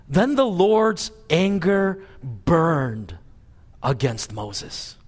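slow and grave, letting each word land; heavy slow reading of the verse; slow solemn scripture reading. Shown as a demonstration. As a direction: read the verse slowly and soberly. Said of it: "No, it doesn't say that".

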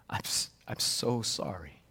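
soft, heartfelt I'm so sorry, leaning on so; mean every word of it; sincere apology. Shown as a demonstration. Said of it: "one of the two versions elicits forgiveness".

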